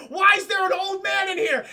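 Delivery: high, loud shout, cracking with laughter; alarmed yell. Retold or imitated. Parents finding an old man in the house, yelling through laughter. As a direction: yell at the stranger while laughing.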